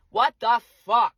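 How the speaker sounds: loud exasperated burst; loud, fed-up disbelief; mad. Real, not acted. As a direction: punch out each word in real anger. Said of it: "I'm so fucking mad".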